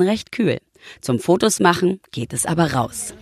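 low reporter voiceover; voiceover narration. A narrator's reading. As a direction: read it like a TV report.

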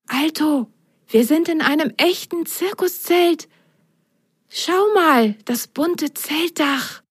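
high, amazed little sock voice; enchanted Belly. Acted. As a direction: marvel at the tent in wonder. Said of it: "flüsterte Belly völlig verzaubert".

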